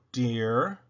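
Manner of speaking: ordinary delivery, nothing marked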